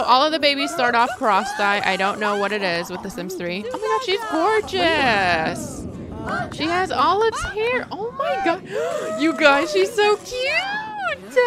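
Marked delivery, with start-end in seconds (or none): 3.7-8.6 very high, stretched-out squeal; real delighted squeal; gushing. Real, not acted. Sees cute toddler. 8.7-9.2 high sharp gasp; excited intake of breath; delighted gasp. Real, not acted. Sees cute toddler. 9.2-11.4 very high, stretched-out squeal; real delighted squeal; gushing. Real, not acted. Sees cute toddler.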